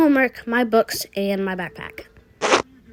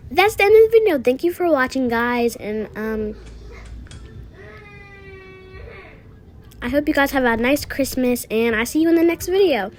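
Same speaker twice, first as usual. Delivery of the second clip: higher and louder, bubbly outro to the viewers; excited sign-off; 8 semitones higher, 5 dB louder than usual. Real, not acted. Kid signing off to her viewers, excited and louder. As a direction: wrap up the video with a big happy send-off.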